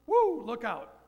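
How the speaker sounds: loud, high whoop of wild memories; excited whoop. Real, not acted. Man recalling his wild youth, loud excited whoop. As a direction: whoop it out like a warning.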